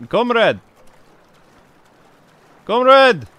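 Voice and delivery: loud call for a comrade; shouting. Pretend. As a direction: hail them in Russian accent. Calling for help in water.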